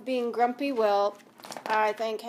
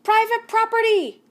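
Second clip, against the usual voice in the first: much higher, louder angry yell; grumpy cactus yelling; 11 semitones higher, 10 dB louder than usual. Acted. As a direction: bark it at the intruder. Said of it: "yelled Hank".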